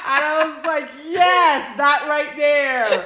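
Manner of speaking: words squeezed out laughing. Real, not acted. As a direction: gush with laughter. Laughing while retelling reaction.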